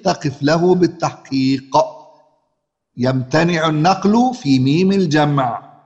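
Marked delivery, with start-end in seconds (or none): 3.0-5.7 slow, deliberate reciting of the rule; rule recitation. Shown as a demonstration. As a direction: spell out the rule like a verse, slowly. (تلاوة القاعدة ببطء)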